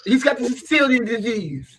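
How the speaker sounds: high-pitched put-on Italian accent; hammed-up Sicilian English; mock Sicilian granddad. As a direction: pretend Sicilian elder giving a diagnosis. Plays along as Sicilian granddad.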